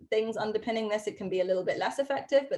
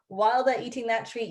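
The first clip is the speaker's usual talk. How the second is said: normal talking voice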